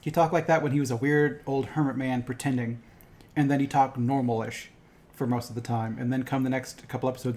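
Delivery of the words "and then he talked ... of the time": normal talking voice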